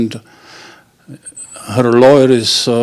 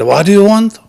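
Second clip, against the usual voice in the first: higher, louder, impatient demand; impatient teller; 8 semitones higher, 6 dB louder than usual. Acted. Teller losing patience with the stammering customer, loud. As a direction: snap the question at him.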